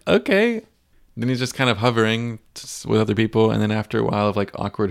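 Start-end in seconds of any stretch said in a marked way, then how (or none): 0.1-0.6 much higher, puzzled rising okay; bewildered high-pitched okay; surprised okay. Retold or imitated. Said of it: "and I was like".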